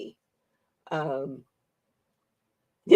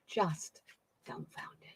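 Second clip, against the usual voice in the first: dumbfounded whispered under the breath; dumbfounded said as a stunned whisper; 14 dB quieter, slower than usual. Real, not acted. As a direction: breathe the word dumbfounded out in awe. Repeats dumbfounded, then whispers it.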